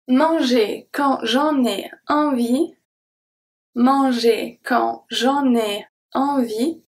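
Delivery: slow manger quand j'en ai envie, N linked to ai; liaison shown. Shown as a demonstration. Teacher modelling the liaison at dictation pace. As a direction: let the N of en run into ai, slowly. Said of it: "the N from en is going to be transferred".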